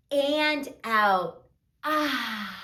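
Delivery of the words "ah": soft open-mouthed breath out; long exhale. Shown as a demonstration. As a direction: let the air out in a long "ahh". Exhale demonstrated in the story.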